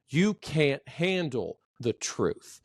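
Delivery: slow declaration falling on "truth"; declarative falling inflection. Shown as a demonstration. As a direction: declare it slowly, drop "truth". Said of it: "It's when you're declaring something".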